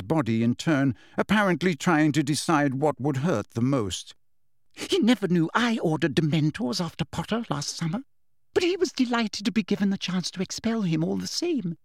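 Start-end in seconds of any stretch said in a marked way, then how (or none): 4.7-11.8 higher, sugary false regret; Umbridge's voice. A narrator's reading. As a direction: feign reluctance, prim and high. Umbridge confesses.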